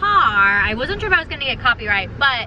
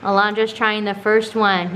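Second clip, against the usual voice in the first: ordinary talk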